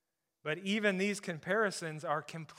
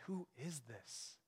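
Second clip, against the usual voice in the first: hushed awe; 12 dB quieter, slower than usual. Acted. Disciples' awed question.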